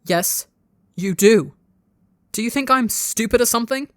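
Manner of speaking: heated, exasperated accusation; snapping. A narrator's reading. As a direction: snap back hard, then press the point. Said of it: "as he snaps".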